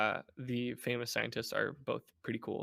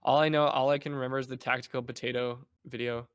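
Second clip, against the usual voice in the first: normal talking voice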